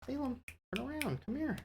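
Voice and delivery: high soft cat-coaxing coo; cooing pet voice. Real, not acted.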